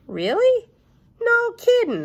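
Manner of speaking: high, loud mock amazement; mock surprise. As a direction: squeak it out like a kid faking surprise. Junie B feigning surprise.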